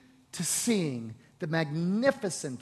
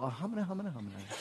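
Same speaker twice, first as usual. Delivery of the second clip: mock mumbling; 7 dB quieter, much faster than usual. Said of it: "That's in your Bible".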